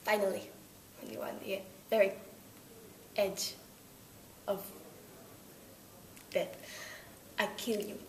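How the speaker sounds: mock-menacing Russian accent. Russian accent, threatening the interviewer with death. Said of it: "with a Russian accent".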